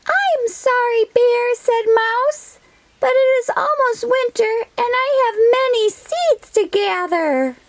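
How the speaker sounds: high, quick squeaky mouse voice; little mouse voice. Acted. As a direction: play a tiny busy mouse.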